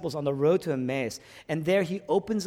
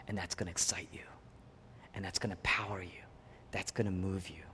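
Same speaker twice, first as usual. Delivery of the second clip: whisper, much lower and softer; whispered promise; 9 semitones lower, 11 dB quieter, faster than usual. Acted. Preacher promising the congregation excitement, in a hush. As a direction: lean in and breathe it to them.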